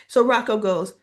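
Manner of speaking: ordinary delivery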